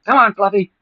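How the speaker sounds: calling the dog. Retold or imitated. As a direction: coax the dog in, sing-song. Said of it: "call his dog in there".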